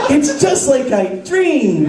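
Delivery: slow, high starry-eyed wonder; slow, high mock-dreamy boy; dreamy kid. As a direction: gush like a kid whose dream came true. Voicing Wesley's dreamy wonder.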